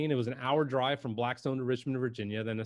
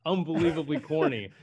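laughing through the words, higher and louder; amused laughing; 4 semitones higher, 6 dB louder, slower than usual. Real, not acted. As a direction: crack up at an embarrassing memory. Laughs recalling his first video.

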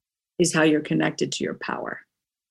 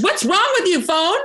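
high, fast mock scold; hammed-up little rant at a phone; 9 semitones higher, faster than usual. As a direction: playfully tell off a phone with a dead battery. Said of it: "Do you yell at your cell phone".

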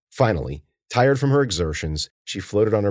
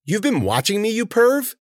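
high, affronted outburst; indignant accusation. Acted. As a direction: accuse the stranger, still startled. Startled swimmer accuses a stranger.

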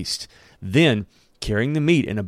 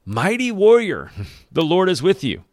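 angel's grand greeting; 8 semitones higher, 4 dB louder than usual. Acted. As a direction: hail him like a heavenly messenger. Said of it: "the angel says".

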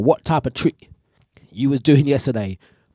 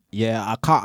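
ordinary delivery

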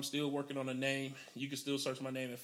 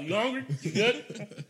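high, loud caring host; 8 semitones higher, 9 dB louder, slower than usual. Retold or imitated. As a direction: fuss over a guest, loud and high.

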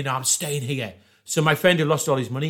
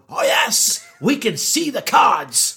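slow, higher gloating; gleeful; 7 semitones higher, 4 dB louder, slower than usual. Retold or imitated. Gamblers gloating to the crowd, slow and gleeful. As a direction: crow it out slowly. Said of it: "we're going".

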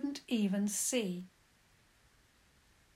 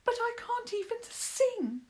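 much higher, desperate little wail; bursting out; 14 semitones higher than usual. A narrator's reading. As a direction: blurt it out in despair. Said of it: "he now burst out".

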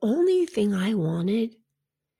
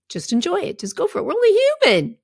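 rising higher and brighter to a happy peak; high encouraging lift; 11 semitones higher, faster than usual. Real, not acted. Coach urging clients to indulge, excited high lift. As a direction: cheer them on, climbing to we're only human.

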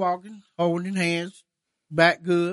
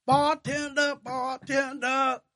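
calling the bartender; 8 semitones higher, 3 dB quieter, slower than usual. Retold or imitated. Drunk bum calling the bartender.

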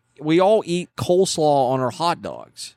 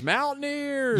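high, drawn-out, softened holler; mock answering yell; 11 semitones higher, 6 dB quieter, slower than usual. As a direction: stretch out a high, held-back mock shout. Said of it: "other side of the church yells".

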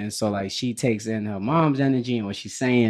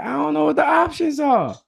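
mock confused kid; 14 semitones higher than usual. Voicing the kids.

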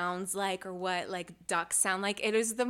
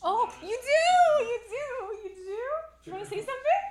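cooing pet talk; 14 semitones higher, 5 dB louder than usual. Real, not acted. Talking to the dog Luna, high-pitched cooing. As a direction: squeal and coo as if to a puppy.

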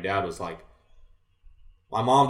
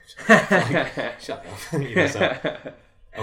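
louder laugh on the first word; 6 semitones higher, 5 dB louder, much slower than usual. Real, not acted. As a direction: laugh off a teasing remark. Embarrassed at being called twelve.

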